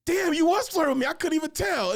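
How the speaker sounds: high and loud dawning shock; squeaky damn you were flirting; mock realisation. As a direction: blurt it as it hits you.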